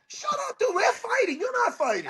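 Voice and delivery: very high, squawking retort; mock outrage. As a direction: shut a heckler down in a high-pitched voice. Acted retort to a heckler.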